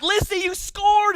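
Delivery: high-pitched, drawn-out cheering; excited parent. Retold or imitated. Acts out cheering his young daughter.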